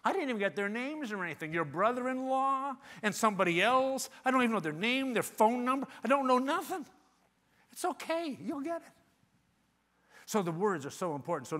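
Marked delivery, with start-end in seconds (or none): 0.0-6.9 mock protest, rising higher and louder; hammed-up clueless objection that builds; Sandy. Client asked for referrals, acting out a protest. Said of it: "He says, um". 7.7-8.9 mock reassurance. Salesman soothing a client short of details, high reassurance.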